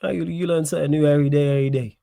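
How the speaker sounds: ordinary talk, nothing marked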